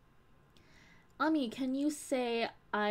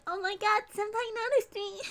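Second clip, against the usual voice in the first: high-pitched, louder fangirl squeal; 12 semitones higher, 3 dB louder than usual. Retold or imitated. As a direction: squeal it like a starstruck fan. Acts out own fangirl reaction.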